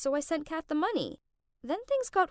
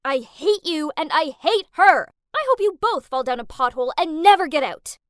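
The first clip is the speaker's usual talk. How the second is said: loud, furious yell; yelling hatred at her half-sister over the phone; 4 semitones higher, 10 dB louder than usual. Acted. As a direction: yell it right in her ear. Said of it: "so I yelled".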